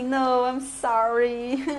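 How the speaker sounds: slow, softened sorry with a little laugh; sweetly apologetic refusal. Shown as a demonstration. Teacher showing how to refuse the phone, sweetly apologetic. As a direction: let them down gently, stretching the sorry. Repeats the polite refusal model.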